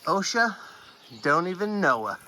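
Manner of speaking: put-on joking Boston accent. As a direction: drop into a Boston accent for the pun. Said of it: "That's a Boston accent".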